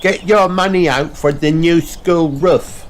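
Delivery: cartoon pup on a microphone; Danny Dog voice. A narrator's reading. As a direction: greet them like a little announcer. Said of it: "he says".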